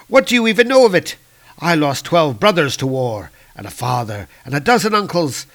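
dark elf lord raging at the wizard's words of peace; furious dark elf. Acted. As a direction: spit each loss back at him. Malekith rages at wizard.

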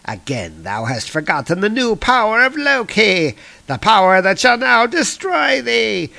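hammed-up boast of power; theatrical rising gloat; gloating villain. Loki boasting new power.

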